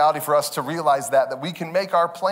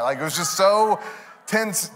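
normal talking voice

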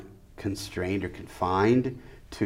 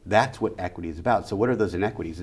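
ordinary talk

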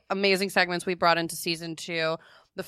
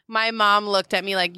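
normal talking voice

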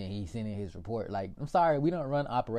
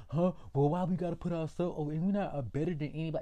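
mocking objectors; 6 semitones higher, 4 dB louder than usual. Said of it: "Some people are like".

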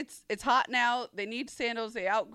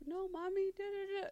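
put-on whiny toddler; 9 semitones higher, 8 dB quieter than usual. Imitating whining toddler.

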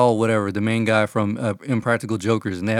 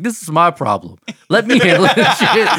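mock upset, rising higher and louder; Andrew Schulz; 7 semitones higher, 6 dB louder than usual. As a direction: imitate a worked-up comic, breaking into laughter. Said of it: "like, getting upset".

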